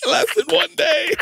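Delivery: high squeal through laughter; laughing hard. Real, not acted. Comic admitting his failed fast to friends, high helpless laughter. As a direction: squeeze the words out while cracking up.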